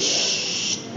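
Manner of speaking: desis shh tanpa suara; desis sya. Shown as a demonstration. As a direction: seperti menyuruh diam, shh. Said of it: "seperti mengisyaratkan jangan berisik". (desis seperti suruh diam)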